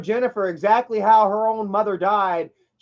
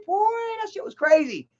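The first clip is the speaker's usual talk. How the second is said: his own reaction on first seeing the video, stunned and much higher; shocked reaction; 11 semitones higher than usual. Retold or imitated. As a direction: gasp it out in disbelief. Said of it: "Because I was like".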